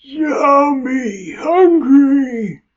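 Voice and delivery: high, slow, groaning monster moan; zombie voice. Pretend. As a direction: drag out a hungry zombie wail. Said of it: "Zombie voices".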